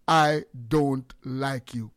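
slow, measured family-voice delivery; deliberate, spaced-out statement at half pace. Shown as a demonstration. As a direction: spell it out slowly, word by word. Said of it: "Let me say it in a family voice".

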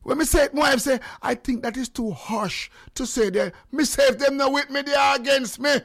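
loud, high, forceful declaiming; heated rant. Real, not acted. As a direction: shout the argument home. Heated rant about who is against him.

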